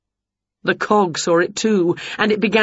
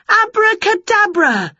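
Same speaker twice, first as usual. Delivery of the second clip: high, loud spell chant; spell cast; 13 semitones higher, 4 dB louder than usual. A narrator's reading. Witch casting a spell on her pets, high and loud. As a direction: ring out the magic word.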